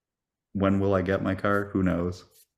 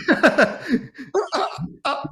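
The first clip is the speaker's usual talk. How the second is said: shrieking laugh, much higher; high burst of laughter; 3 dB louder than usual. Real, not acted. Caught off guard by a mic noise, shrieking laugh.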